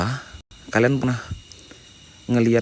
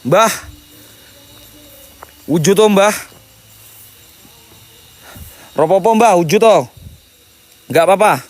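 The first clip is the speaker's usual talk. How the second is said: coaxing call; 6 semitones higher, 4 dB louder than usual. Real, not acted. Begging spirit to appear.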